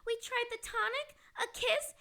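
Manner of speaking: normal talking voice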